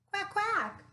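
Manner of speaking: high, bright duck quacks. Acted. George quacks at the vet.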